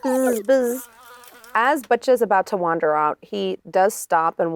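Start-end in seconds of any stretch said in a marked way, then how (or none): 0.0-0.9 bee voice. Acted.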